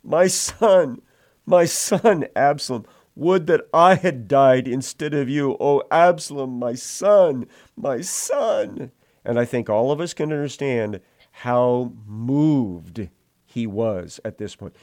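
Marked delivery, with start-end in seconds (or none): 0.0-8.9 high, loud anguished lament; grieving cry. Acted. Father David wailing over his dead son, high and loud. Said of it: "And as he went, he said".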